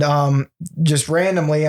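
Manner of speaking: normal talking voice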